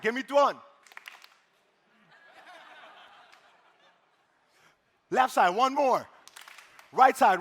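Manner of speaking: loud, slow call to the crowd. Acted. Emcee calls out a clap game to both sides. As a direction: call each side like a cheerleader.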